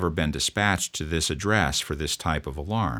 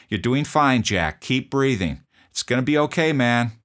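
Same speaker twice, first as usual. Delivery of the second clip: much higher, louder urging; urgent encouragement; 8 semitones higher, 6 dB louder than usual. Retold or imitated. Officer encouraging the patient as he starts breathing, loud urging. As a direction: cheer him on to keep breathing.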